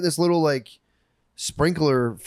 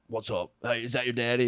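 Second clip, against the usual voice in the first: put-on softened teen; 7 dB quieter than usual. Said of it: "the dude was just like".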